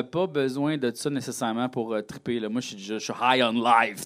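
ordinary talk, nothing marked

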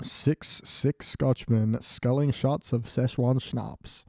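very slow, deliberate tongue twister; careful tongue twister. Shown as a demonstration. Retrying the tongue twister, very slow and deliberate. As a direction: sound out every word slowly. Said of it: "Now say it with a German accent".

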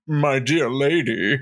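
slow, put-on charmer voice; lightly hammed-up gallant suitor; Lord Radriel. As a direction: play a fey seducer greeting a lady. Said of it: "teleport up to her and be like".

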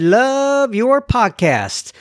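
"Love your podcast" drawn out slow and high, every word leaned on; big capital letters. Shown as a demonstration. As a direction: read it out like it's written in capitals. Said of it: "That's why I said it like that".

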